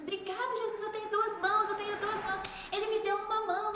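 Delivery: high, breathless-with-joy imitation of the healed man; overjoyed thanks. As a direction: exclaim it as if jumping for joy. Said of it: "pular de felicidade".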